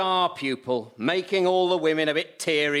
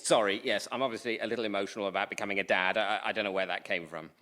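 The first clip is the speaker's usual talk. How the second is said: lower, softer, fast embarrassed excuse; flustered mumble; 7 semitones lower, 6 dB quieter, faster than usual. Acted. Dad caught swooning in class, fast flustered mumble. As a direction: rush the excuse under your breath.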